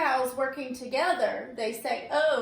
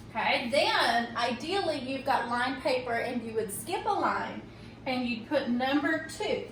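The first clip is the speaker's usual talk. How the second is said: ordinary talk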